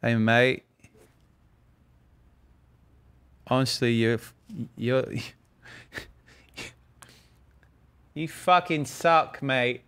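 badly faked British voice; awful British accent. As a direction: do a hammy, halting British accent. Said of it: "my awful British voice".

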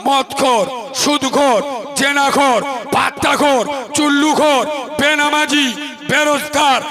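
loud sermon roar, hammering out each word; fiery preaching. Acted. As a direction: thunder the list at the crowd like a preacher. Waz preacher at full force.

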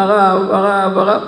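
mock-weeping call to the rabbi; tearful pleading. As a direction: cry out as a pleading widow, without real sobs. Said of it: "היא בוכה, בוכה".

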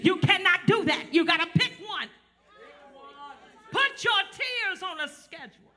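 loud, rhythmic sermon, pushing hard to the crowd; hammering the congregation with call and response; fiery preaching. Acted. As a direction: preach it at full force to the room. Preaching to congregation, full force.

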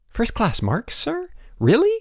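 can't believe the good news, sir?; excited exclamation. A narrator's reading. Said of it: "exclaimed Boots".

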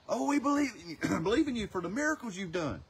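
loud, high mock adoration; mock gushing believers. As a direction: ham up the crowd praising the miracles. Mimicking the sign-seeking crowd.

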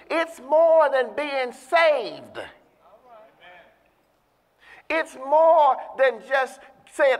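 slow, loud, rising preacher's cadence; fervent slow sermon emphasis; preaching at full force. Acted. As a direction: drive it home to the congregation. Preaching builds up.

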